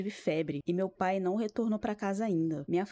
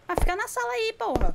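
high, loud fed-up shout; exasperated yell; 14 semitones higher, 7 dB louder than usual. Real, not acted. Streamer yelling at the stubborn game character, exasperated shout. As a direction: yell at the screen in frustration.

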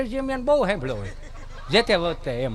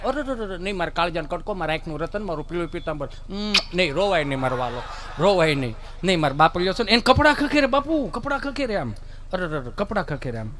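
much softer, tender coo; soothing mother; 4 semitones lower, 4 dB quieter than usual. Retold or imitated. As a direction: hush the little lion gently. Mother soothing crying child. (ધીમા, લાડભર્યા અવાજે બાળકને મનાવતી મા)

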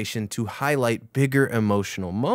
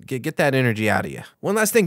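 normal talking voice

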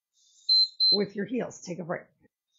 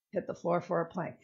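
ordinary talk, nothing marked

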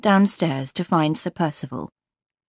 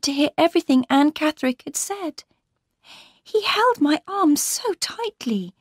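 put-on high-pitched, urgent young wife; anxious heroine, pitched well up; distressed Laura; 11 semitones higher than usual. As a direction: pour out the story in a high, upset voice.

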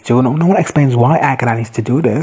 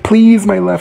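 high, louder desperate please; pleading; 7 semitones higher, 4 dB louder, slower than usual. Retold or imitated. Mother begging her lover for her son. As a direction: beg one more time.

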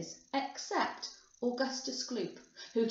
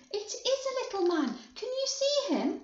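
put-on high-pitched, amazed child; excited boy calling to grandfather; excited cry; 11 semitones higher than usual. A narrator's reading. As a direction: ask it eagerly, pitch climbing high. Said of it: "cried Charlie".

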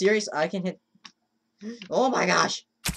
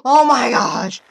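loud, high outburst of exasperation; frustrated shout; 6 semitones higher, 12 dB louder, slower than usual. Real, not acted. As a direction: yell it out in frustration. Frustrated outburst at failing the game.

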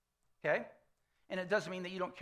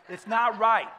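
emphatic; 3 semitones higher, 10 dB louder, slower than usual. Real, not acted. Confessing smashing ball at wife.

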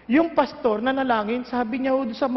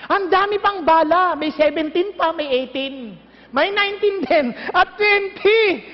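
forceful preaching; 3 semitones higher than usual. Acted.

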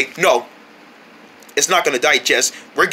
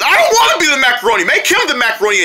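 fast, high-pitched objection; whiny protest; 8 semitones higher, faster than usual. Acted. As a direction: object quickly, pitch raised. Protests assigned role.

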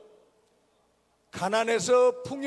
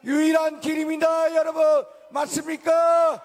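rousing shout; 5 semitones higher, 3 dB louder than usual. Real, not acted. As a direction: demand the crowd's answer. Rally call to crowd.

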